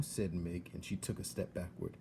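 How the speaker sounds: ordinary delivery, nothing marked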